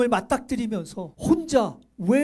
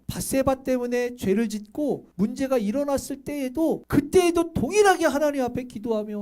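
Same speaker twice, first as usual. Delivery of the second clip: usual speaking voice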